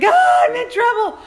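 high, loud yelp of a scared dog; panicked dog voice. Retold or imitated. Voicing the frightened dog fleeing down the stairs, shrill panic. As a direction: squeal it like a dog sure she's in trouble. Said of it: "And she was like".

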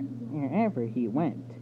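usual speaking voice

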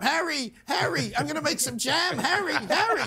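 high breathy mock Meghan voice, pleading; squeaky plaintive calls for Harry; Meghan Markle impression. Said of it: "give us a Meghan impression".

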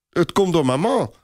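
put-on high, serious child voice; high-pitched childlike earnestness; earnest little boy. A narrator's reading. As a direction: explain it solemnly as a small boy. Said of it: "zegt kleine Robert ernstig".

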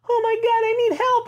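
high, desperate plea for help; frantic civilian. Retold or imitated. Fleeing woman begging the soldiers for help, high frantic squeal. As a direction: squeal it like a terrified bystander.

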